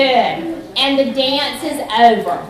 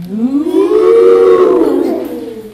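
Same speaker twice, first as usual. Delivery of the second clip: cow moo; 3 semitones higher, 9 dB louder than usual. Acted. Animal sound in farm dance.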